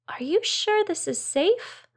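put-on high worried child's question; nervous child. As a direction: ask it scared and small. Zara nervous at toffee bridge.

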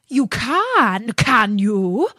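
lightly snarled wolf challenge; snarling wolf. A narrator's reading. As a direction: snarl it a little, loudly. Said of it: "snarled the wolf".

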